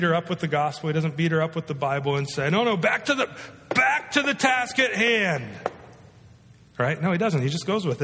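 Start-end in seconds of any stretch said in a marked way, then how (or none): 2.5-5.4 high, slowed, put-on voice insisting on the point; hammed-up scold dragging her back to the task; mock insistent preacher. Mock harsh preacher insisting on topic.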